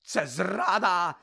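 slow loud roar; loud roar of betrayal. Acted. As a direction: bellow the accusation. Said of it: "заревів він".

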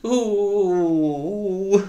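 high loud wail of sobbing; acted sobbing wail. A narrator's reading. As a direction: burst into tears. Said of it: "по-справжньому заридала". (гучне ридання)

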